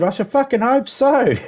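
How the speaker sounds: high-pitched sarcastic comeback; annoyed smart-ass. Retold or imitated. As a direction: fire back like an exasperated smart aleck. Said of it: "becoming a real smart ass when I get annoyed".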